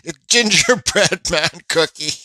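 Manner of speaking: laughing. Real, not acted. Laughs at the huge cookie.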